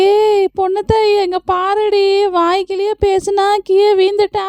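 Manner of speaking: high teasing voice, jeering at her; mocking onlooker. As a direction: jeer up high at the fallen woman. Mocking the fallen woman. (உயர்ந்த குரலில் கேலி செய்தல்)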